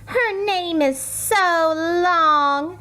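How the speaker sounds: high, slow sneering schoolgirl; mocking classmate, high and slow; snide schoolgirl. A narrator's reading. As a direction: drag it out to mock her. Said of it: "said Jo".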